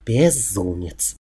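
low, soft, drawn-out dismay; exasperated woman. A narrator's reading. She gives in to his crazy plan, low and exasperated. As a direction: slowly sigh it out, half under your breath.

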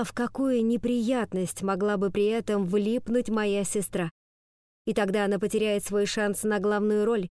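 usual speaking voice

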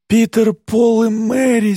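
high, strained wheezy squeeze; wheezing rasp. Acted. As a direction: force it out hoarse and wheezing. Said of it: "сипло выдавливаю я".

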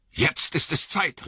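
the wizard urges them to leave; Gandalf calling. A narrator's reading. Gandalf ruft zum Aufbruch, drängend. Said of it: "rief Gandalf".

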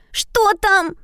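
put-on high squeaky girl voice; squeaky little girl. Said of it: "пищала Мари".

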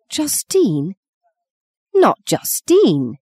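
slow, rising alarmed outcry; horrified that her daughter knows; alarmed cry. A narrator's reading. Maggie cries out in alarm that Justine knows. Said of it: "Maggie cried".

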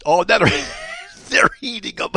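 usual speaking voice

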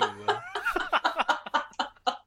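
high-pitched burst of laughter; real high laughing fit. Real, not acted. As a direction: crack up at your own joke. Laughs at own retort.

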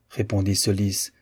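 plain reading voice

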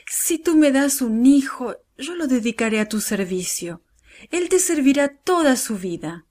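earnest, high-pitched plea; praying. A narrator's reading. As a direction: pray it as a solemn promise, voice lifted. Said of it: "oró ella".

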